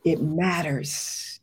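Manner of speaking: drawn-out, weighty pronouncement; slow and heavy. Real, not acted. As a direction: let each word sink in slowly. Emotional repeated affirmation.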